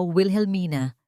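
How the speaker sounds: ordinary delivery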